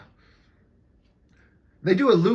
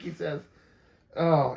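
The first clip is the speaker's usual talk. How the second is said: usual speaking voice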